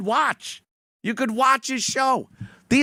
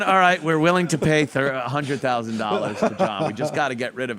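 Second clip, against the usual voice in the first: low lawyer voice; 6 semitones lower, faster than usual. Retold or imitated.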